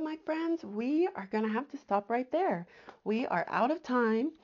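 normal talking voice

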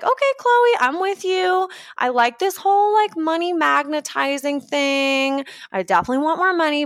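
high, excited viewer voice; a fan keen but unsure; eager viewer. Retold or imitated. Viewer answering Chloe, high eager agreement.

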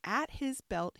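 ordinary delivery, nothing marked

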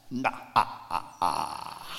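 faint, aghast trailing gasps; stunned gasping noises. Acted. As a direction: let the shock drain out in faint breaths. Stunned reaction to the teaching.

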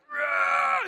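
a short unvoiced roar, loud; mock roar. Preacher imitating his son bursting in, a loud unvoiced roar. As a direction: bark it like a tough little boy. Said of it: "Gavin is like".

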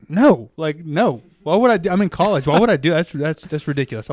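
loud, high incredulous objection; mock-outraged college student balking; indignant protest. Retelling own protest to priest's suggestion.

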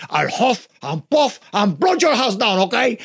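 big bad wolf with a Cuban accent. Shown as a demonstration. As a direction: play the wolf as a Cuban relative. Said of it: "my big bad wolf is a Cuban".

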